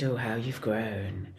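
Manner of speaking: low, slow, sultry purr that stirs the loins; Nigella Lawson impression. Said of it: "an impression of, um, Nigella Lawson".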